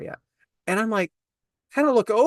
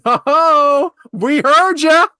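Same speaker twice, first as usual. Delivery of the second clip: higher, louder, sing-song tease; teasing call-out; 7 semitones higher, 7 dB louder, much slower than usual. Retold or imitated. Gym worker teasing the tanning-bed rapper, higher and louder. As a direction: needle him that everyone heard.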